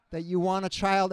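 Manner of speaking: ordinary talk, nothing marked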